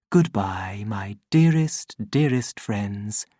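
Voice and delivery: tender whispered farewell; whispered goodbye. Acted. Voice fading, repeating farewell.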